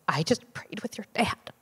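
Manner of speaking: a whispered, sob-choked confession; tearful whisper. Retold or imitated. Husband whispers to his wife through sobs, tearful whisper. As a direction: breathe it out through tears, barely voiced. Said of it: "just completely sobbing".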